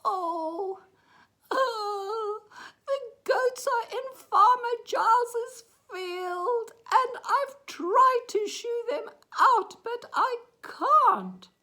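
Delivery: very high, loud wail; acted crying. A narrator's reading. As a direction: sob out the complaint as a small boy. Crying boy answers the horse.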